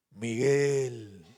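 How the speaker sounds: low, drawn-out eerie calling of a name; slow, deep mock-divine summons; mysterious night voice. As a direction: play a mysterious voice calling someone at midnight. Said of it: "a la medianoche escucha una voz".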